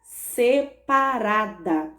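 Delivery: separada very slowly, syllable by syllable; drawn-out, split-up separada; syllables separated. Shown as a demonstration. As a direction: break separada into se-pa-ra-da. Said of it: "separo a palavrinha e a vogal fica".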